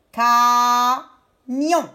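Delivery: syllable-by-syllable "camion"; split into syllables. Shown as a demonstration. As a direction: hold "ca", pause, add "mion". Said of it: "Je prends la première syllabe".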